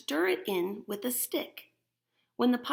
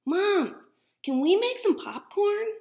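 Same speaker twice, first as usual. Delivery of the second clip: put-on much higher, louder begging child; kid voice; 11 semitones higher, 7 dB louder than usual. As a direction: play an eager little boy asking Mom. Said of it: "the voice bubbles say".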